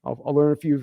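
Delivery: ordinary delivery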